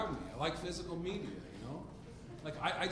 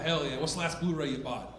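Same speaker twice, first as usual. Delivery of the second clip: ordinary talk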